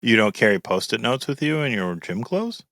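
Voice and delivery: ordinary talk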